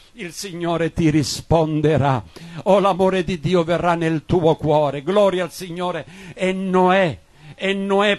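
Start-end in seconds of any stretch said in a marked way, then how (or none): none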